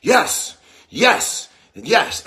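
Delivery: loud, high yells of a fired-up coach; punchy, high-pitched coach yell; Russian gymnastics coach. Retold or imitated. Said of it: "like a Russian gymnastics coach".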